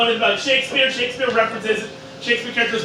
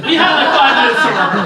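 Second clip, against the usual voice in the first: laughing; 6 semitones higher, 6 dB louder, faster than usual. Real, not acted.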